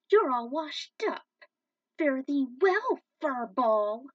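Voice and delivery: loud, slow mocking farewell; gloating villain. A narrator's reading. Villain taunts Cheetah before dropping her, gloating. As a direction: taunt her as you let her drop.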